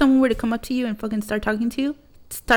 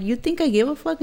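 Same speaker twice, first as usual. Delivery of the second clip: higher-pitched scoffing challenge; defiant retort; 6 semitones higher than usual. Retold or imitated. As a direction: imitate mom's who-cares comeback, voice lifted. Mom's retort, retold a second time.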